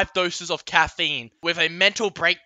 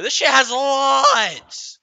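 exasperated; 9 semitones higher, 7 dB louder, slower than usual. Real, not acted. Outraged at fan lights.

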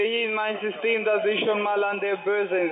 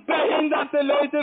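heated, raised voice; angry; 6 semitones higher, slower than usual. Real, not acted. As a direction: spit the insult out slowly. Shouting insult in argument.

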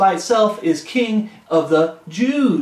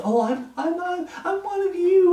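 mimicking a pleading hypocrite, higher; mock whiny claim; 10 semitones higher than usual. Preacher mimics hypocrites claiming to belong, mock whine. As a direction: whine it like a fake insider. Mocking the false believers' claim.

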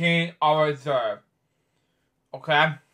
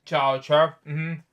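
plain reading voice

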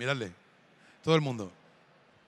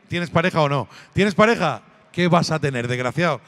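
loud mocking taunt; teasing loud; 2 semitones lower, 8 dB louder than usual. Real, not acted. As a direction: rib the gamer loudly. Teasing a gamer loudly.

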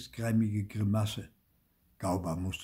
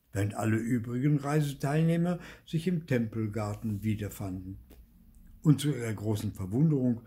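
ordinary delivery

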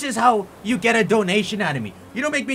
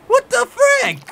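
very high, loud outraged yell; full-throated outrage; furious shout; 8 dB louder, slower than usual. Real, not acted. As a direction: scream it at the screen. Outburst at the note.

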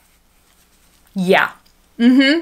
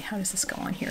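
whisper; 13 dB quieter, faster than usual. Real, not acted. Muttering while dressing doll.

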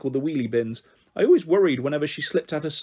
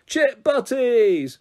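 high, slow gleeful yell; excited chorus of chip butties; yelled together; 8 semitones higher, 4 dB louder, much slower than usual. Acted. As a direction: shout it out together, delighted. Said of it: "and then yelled simultaneously".

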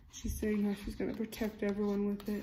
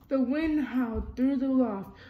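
lively storybook reading, character lines a bit louder; reading to children; 3 semitones higher, 7 dB louder than usual. A narrator's reading. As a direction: read it like a bedtime picture book. Reading picture book aloud.

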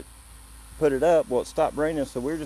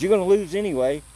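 retold yell; 7 semitones higher, 3 dB louder than usual. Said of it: "'Cause he kept yelling".

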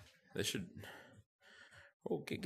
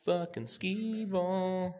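mock drawl; 11 semitones higher, much slower than usual. Real, not acted. Drawn-out mock of game.

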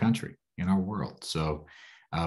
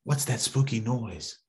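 ordinary delivery

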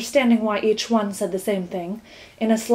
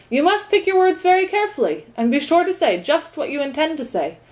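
higher, emphatic warning; cautioning; 7 semitones higher, 4 dB louder than usual. A narrator's reading. Count advises on choosing words, cautioning. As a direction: stress each point as careful advice. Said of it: "cautioned the count".

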